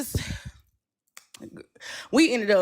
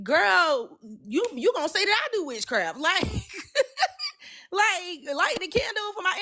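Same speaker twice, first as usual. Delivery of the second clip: incredulous challenge; 10 semitones higher than usual. Retold or imitated. Retelling her own reply to friend.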